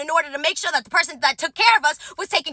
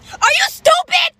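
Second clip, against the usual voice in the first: much higher, slow, louder outraged shriek; shout; 12 semitones higher, 4 dB louder, slower than usual. Retold or imitated. To the rude diners, slow shocked shriek. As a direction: stretch it out in disbelief.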